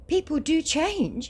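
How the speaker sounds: high, slow hopeful plea; hopeful scammer. Acted. Scammer hoping to be friends, high hopeful plea. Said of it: "he says hopefully".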